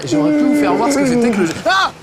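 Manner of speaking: loud, high, hammed-up surprise; overacted gasp of surprise; mock surprise. Fake surprise at friend appearing.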